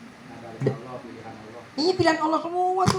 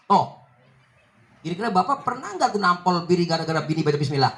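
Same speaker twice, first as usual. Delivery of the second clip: ordinary talk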